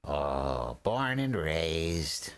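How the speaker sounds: very slow goblin drawl; lightly voiced slow goblin; goblin prisoner. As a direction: stretch it out like a smug goblin.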